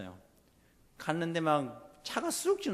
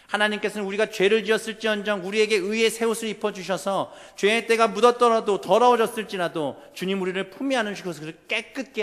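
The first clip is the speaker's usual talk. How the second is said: raised, louder pulpit proclamation; impassioned preaching; 7 semitones higher, 10 dB louder than usual. Acted. Preacher tells congregation God knows their struggle, impassioned preaching. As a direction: press each phrase home to the congregation.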